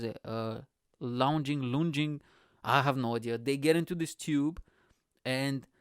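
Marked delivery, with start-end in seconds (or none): none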